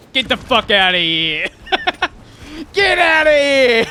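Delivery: high, loud New York "get outta here"; New York shout. Acted. Demon dismisses the player, New York shout. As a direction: bellow it in a New York accent.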